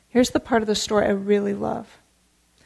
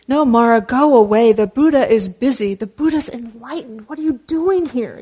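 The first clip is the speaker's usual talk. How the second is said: shooing away; 5 dB louder than usual. Acted. Ananda shooing Mara away.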